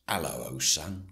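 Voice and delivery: put-on light old-school London Cockney; a hint of matey Cockney; Cockney accent. Shown as a demonstration. Suggesting a voice for the droid, light Cockney accent. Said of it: "Bit more Cockney, old school London".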